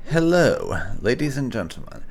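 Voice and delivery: ordinary delivery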